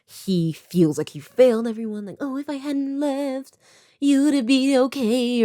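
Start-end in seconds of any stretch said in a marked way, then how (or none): 2.2-5.4 self-pity. Retold or imitated. Harrison's self-loathing quote.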